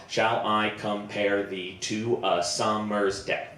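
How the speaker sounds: slow, evenly beaten "Shall I compare thee to a summer's day"; measured ten-syllable meter demo; counted-out iambic syllables. Shown as a demonstration. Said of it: "we know it's ten syllables long".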